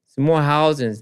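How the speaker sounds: ordinary talk, nothing marked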